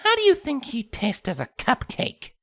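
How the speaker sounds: high, sweetly menacing pony voice; a creepy sing-song pony; Pinkamena voice. A narrator's reading. Pinkamena asks the others, high and menacing.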